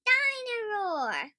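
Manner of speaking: put-on a small boy calling out the toy's name; George voice. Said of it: "says George".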